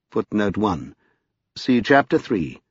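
plain reading voice

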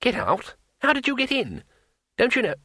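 high-pitched, puzzled character voice; high voice of a puzzled innocent; baffled questioning. Acted. Baffled Two Flower questions the wizard.

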